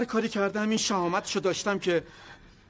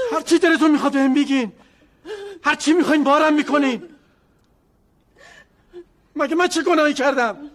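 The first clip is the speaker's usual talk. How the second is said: loud defensive protest; 7 semitones higher, 6 dB louder than usual. Acted. Father defends himself loudly.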